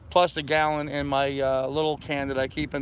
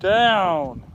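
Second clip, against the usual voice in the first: louder, higher stern "Down"; stern command; 4 semitones higher, 6 dB louder than usual. Real, not acted. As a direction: bark the order at the dog. Commanding the dog to lie.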